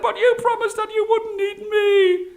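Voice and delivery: very high, loud squealing protest; panicked shout. Acted. Brahmin pinned under the tiger, shouting in panic. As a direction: cry out at a shrieking pitch. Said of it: "and he shouted".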